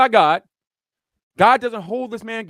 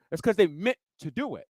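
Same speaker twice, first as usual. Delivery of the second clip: ordinary talk, nothing marked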